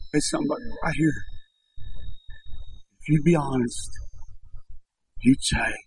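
soft voice sinking to a breath; hushed, heartfelt appeal. Real, not acted. Preacher to somebody in the congregation, hushed and heartfelt.

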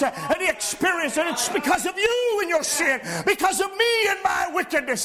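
high, ringing preacher's cry building on every line; fervent sermon climax, half-shouted; full-force preaching. Real, not acted.

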